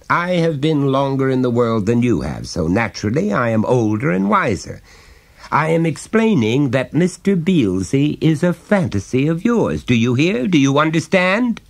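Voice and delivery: a hint of a chummy dad prying gently; jovial father. Father coaxing his small son to confide, lightly voiced.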